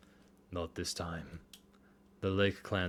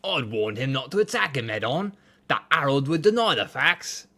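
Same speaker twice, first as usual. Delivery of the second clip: put-on louder Cockney accent, higher; bad Cockney accent; 5 semitones higher, 7 dB louder than usual. [name] explains the warning he gave, in a rough Cockney accent. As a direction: keep up the shaky Cockney. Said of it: "They're bad accents".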